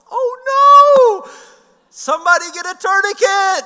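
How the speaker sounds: put-on high, very loud panicked kid's yell; yelled alarm. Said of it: "Zoe, my ten-year-old, yells".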